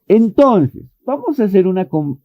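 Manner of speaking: ordinary talk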